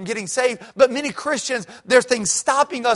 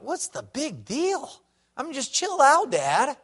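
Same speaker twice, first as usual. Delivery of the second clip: put-on higher, slow, dismissive teen voice; sulky kid; 6 semitones higher, 3 dB quieter, slower than usual. Young son brushing off his frustrated dad, higher and dismissive. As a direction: brush off your father like a bored kid. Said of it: "And I thought".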